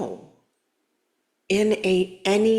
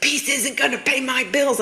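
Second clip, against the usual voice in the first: mock complaint pitched higher; a hint of the client yelling back; imitating angry client; 7 semitones higher than usual. Client yelling at her coach, lightly mocked. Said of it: "she would call and yell at me".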